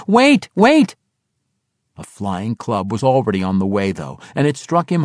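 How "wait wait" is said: high, loud frantic shouts; wild scream. A narrator's reading. Halfling surfaces waving his arms at his attackers, screaming wildly. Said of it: "screaming wildly".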